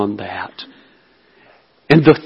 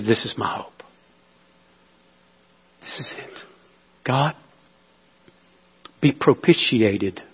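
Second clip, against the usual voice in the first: hushed whisper; 3 semitones lower, 7 dB quieter than usual. Acted. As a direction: drop to an awed whisper. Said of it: "hushed prayer of the sinner".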